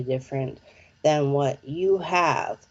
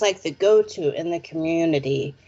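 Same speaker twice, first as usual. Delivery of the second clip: usual speaking voice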